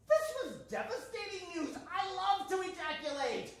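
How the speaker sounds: high-pitched comic lament; exaggerated dismay, pitched high; mock devastation. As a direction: wail it a little, hammed up for laughs. Comic outcry at bad news.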